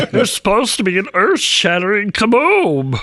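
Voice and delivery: high cartoon alien voice, laughing. Comic humping the mic on stage, Martian voice.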